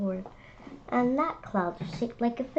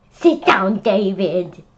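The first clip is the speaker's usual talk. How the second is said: loud teacher command; stern order; 8 dB louder, slower than usual. Acted. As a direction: bark it at the class clown.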